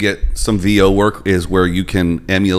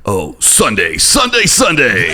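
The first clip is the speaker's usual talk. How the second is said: monster truck ad; 3 semitones higher, slower than usual. Shown as a demonstration. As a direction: bellow it like a monster truck rally. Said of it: "monster truck voice".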